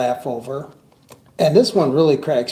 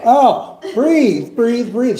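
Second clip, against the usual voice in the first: Vicki; 7 semitones higher, 5 dB louder, much slower than usual. Retold or imitated. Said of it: "she goes".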